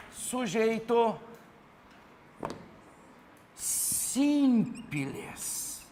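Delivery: drawn-out words with long gaps; syllables stretched for note-taking; slow dictation. Real, not acted. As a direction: dictate it very slowly as you write. Dictating term while writing it down.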